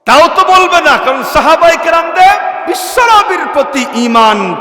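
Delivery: high, loud exhortation; full-force preaching. Real, not acted. Preacher rouses listeners about the companions' faith, full-force preaching.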